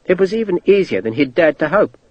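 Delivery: plain reading voice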